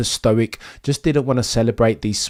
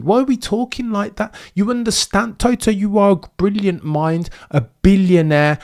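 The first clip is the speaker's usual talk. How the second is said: exasperated; 6 semitones higher than usual. Real, not acted. Exasperated at Toto.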